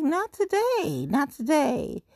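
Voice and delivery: high, sassy brush-off; sassy refusal. Retold or imitated. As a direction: wave the bees off, sassy and high. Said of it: "I was just like".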